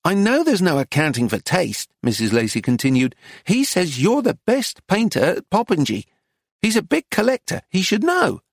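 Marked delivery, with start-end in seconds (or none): 0.0-1.9 higher-pitched, grudging dealer; cross woman's higher voice; peeved shopkeeper. Acted. Missus Lacy carries on scolding. 3.4-8.4 high-pitched, pressing dealer; exasperated woman's high voice; insistent shopkeeper. Acted. Missus Lacy presses the artist.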